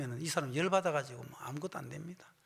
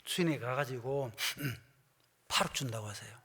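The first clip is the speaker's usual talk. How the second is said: ordinary delivery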